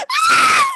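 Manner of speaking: high piercing shriek; startled scream. Acted. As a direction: scream in fright. Scream on being caught.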